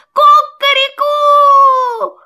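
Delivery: loud, high, slow rooster call; rooster crow. A narrator's reading. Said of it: "закричал".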